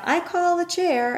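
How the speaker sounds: little sister calling dibs; mock yell. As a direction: shout it as a kid grabbing a seat. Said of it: "She'd yell".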